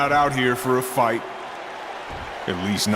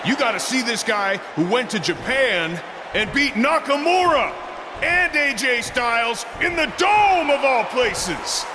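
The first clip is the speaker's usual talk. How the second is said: hyped-up promo; 6 semitones higher than usual. Acted. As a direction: talk up the feat for the crowd. Wrestler builds hype in promo speech.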